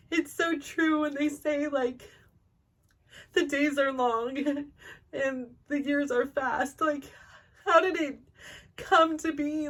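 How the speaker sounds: choked up, fighting tears; tearful. Real, not acted. Mother reflecting on time passing, choked up. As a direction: voice rising and breaking with emotion. Tearing up remembering her kids.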